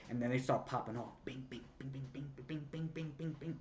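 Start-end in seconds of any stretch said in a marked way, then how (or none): 1.2-3.6 soft, rapid-fire bings; fight sound effects. Retold or imitated. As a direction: patter out quick little weapon pings. Imitates fighting noises.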